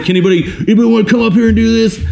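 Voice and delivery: high, fast hype-man challenge; hammed-up martial arts instructor calling for volunteers; Rex Kwon Do. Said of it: "I sound like Rex Kwon Do".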